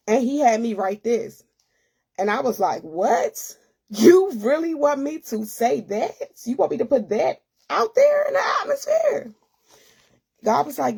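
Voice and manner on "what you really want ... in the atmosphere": rising into a high, tearful, near-shouted disbelief; can't believe what's being asked; disbelieving outcry. Retold or imitated. Retelling her reply to God's request, rising tearful disbelief.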